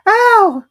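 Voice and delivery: very high, loud squeal; high cry of pain. A narrator's reading. Piglet crying out in the bath. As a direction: yelp as if scrubbed too hard. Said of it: "cried Piglet".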